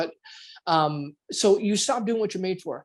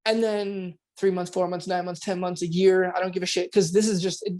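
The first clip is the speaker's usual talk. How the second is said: ordinary talk, nothing marked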